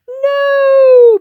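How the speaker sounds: long, loud, high wail; panicked scream. Acted. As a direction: cry out as he plummets. Crowd panics as he falls.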